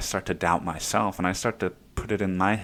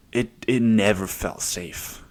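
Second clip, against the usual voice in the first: normal talking voice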